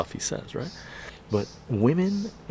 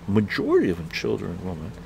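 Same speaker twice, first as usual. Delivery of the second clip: usual speaking voice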